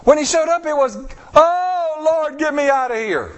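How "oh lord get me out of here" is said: mock plea. Mocking Israelites crying to God.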